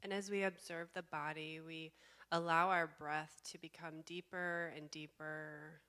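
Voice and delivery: slow, calm meditation guide with long pauses; guided meditation. Real, not acted. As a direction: guide them slowly into stillness, leaving space between phrases.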